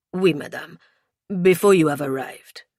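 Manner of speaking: put-on French-accented confirmation; reply firmly with a French accent; courteous, emphatic French accent; French inspector. A narrator's reading.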